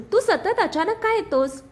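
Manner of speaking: annoyed, pitched well up; irritated. A narrator's reading. Woman annoyed by colleague interrupting, irritated. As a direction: snap at the intruder in irritation. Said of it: "Arya त्रासून".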